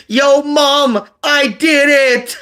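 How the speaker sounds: re-enacted shout to a crowd, high and loud; triumphant yell. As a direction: holler it out proudly to Mom, stretching the last words. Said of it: "I yelled out to the crowd".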